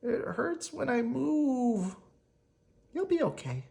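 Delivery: high, slow small voice; lightly voiced hurt child. Child voices in waiting room.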